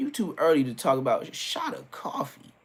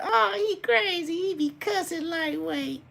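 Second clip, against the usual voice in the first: high, slow, amazed grown-ups; high scandalized voice; high mock adults. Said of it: "They look at that".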